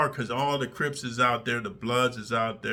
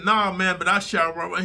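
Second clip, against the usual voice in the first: loud retort; 6 semitones higher, 5 dB louder than usual. Retold or imitated. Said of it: "I said".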